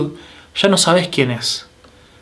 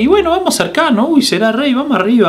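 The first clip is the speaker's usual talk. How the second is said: mock excited crowd; 9 semitones higher, faster than usual.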